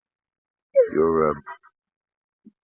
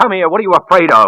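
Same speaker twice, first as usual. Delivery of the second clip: loud, higher, frenzied yell; frenzied shout; 9 semitones higher, 8 dB louder than usual. Acted. Deranged man shouts at the terrified woman. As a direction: lose control as you shout.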